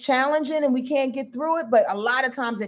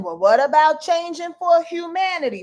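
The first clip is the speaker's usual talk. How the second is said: higher, insistent mock objection; Pluto; 6 semitones higher, 4 dB louder than usual. Voicing Pluto objecting to Mars, higher put-on protest. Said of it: "Pluto again".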